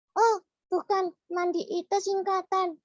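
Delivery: put-on squeaky child puppet voice, confused; high puppet voice. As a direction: play the little puppet mishearing. Puppet voice of Mulia.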